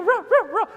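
high, loud answering barks; quick high-pitched ruffs; imitated dog bark. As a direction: another dog joining in. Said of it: "the other neighborhood dog will go".

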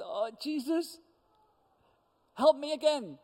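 high, pleading cry for help; panicked plea, voice jumping up; desperate plea. Retold or imitated. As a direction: beg Jesus in a panic. Panicked woman calls to Jesus.

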